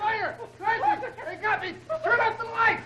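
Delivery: high, frantic yelling in the dark; panicked cry. Acted. Inspector cries out to Clancy in the sudden dark, panicked. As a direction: cry out in alarm as the lights fail. Chaos in dark, shot fired.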